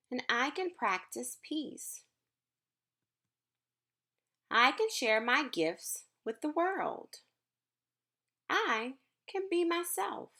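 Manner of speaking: gentle, measured picture-book reading; reading to children. Acted. Teacher reading a book to her class, gentle storytime voice. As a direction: read each line slowly to the class.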